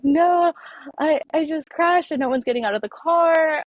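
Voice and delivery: high, loud, frantic reply; panicked. Retold or imitated. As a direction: blurt it out in shock. Distressed self in the crash.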